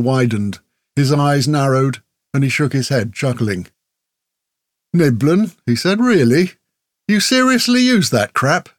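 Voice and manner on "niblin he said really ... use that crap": rising in pitch with disbelief; incredulous mockery. A narrator's reading. Stranger mocking Grell's gear, incredulous and climbing high.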